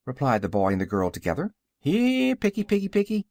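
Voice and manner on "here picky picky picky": high-pitched anxious call to pets; calling out anxiously in a raised pitch. A narrator's reading. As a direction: call the piglets, worried and high. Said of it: "called their master anxiously".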